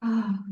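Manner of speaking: audible relaxing sigh. Shown as a demonstration. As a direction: exhale on an open, releasing "ah". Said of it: "You can sigh".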